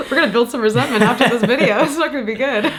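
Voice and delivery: quick and giggling; laughing joke. Real, not acted. Joking with her co-host about resentment, laughing. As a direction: laugh through the mock threat.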